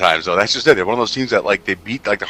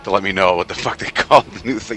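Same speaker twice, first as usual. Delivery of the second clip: ordinary delivery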